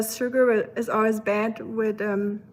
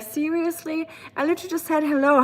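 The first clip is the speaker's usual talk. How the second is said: higher-pitched, incredulous retort, speeding up; mock exasperation; 6 semitones higher, faster than usual. German woman to curious strangers, mock exasperation. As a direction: play up the disbelief at being singled out. Retells her exasperation at being asked.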